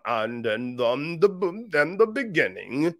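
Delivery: imitating a young preacher's put-on voice; halting, affected pulpit delivery; mock deep preacher. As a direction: play a youngster trying to sound deep. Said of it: "you coming in here talking about".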